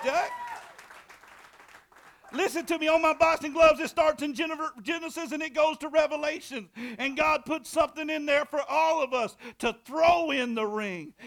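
loud, high, fiery preaching; boxing-ring sermon, loud and rousing; preaching at full force. Acted. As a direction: shout it to the congregation like a revival preacher.

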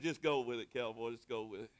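low, softly dropped retold aside; hushed, low-pitched throwaway reply; offhand reassurance. Brushes off Cooper's puzzled reply.

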